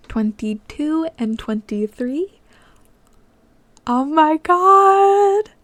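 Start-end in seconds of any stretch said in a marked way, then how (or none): 3.8-5.5 high, slow, loud burst of delight; excited squeal. Real, not acted. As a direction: explode with joy. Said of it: "I'm so excited".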